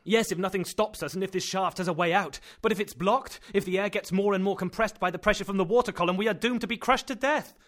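loud and high, rising toward panic; building dread, voice climbing; fearful outburst. Acted. Axel fears being crushed.

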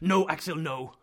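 sharp, forceful call that then eases off; urgent restraint. A narrator's reading. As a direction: stop him at once. Uncle checks nephew leaping up.

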